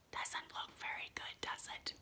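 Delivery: soft teasing whisper. Acted. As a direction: breathe it out as a whispered joke. Teasing aside about the cover.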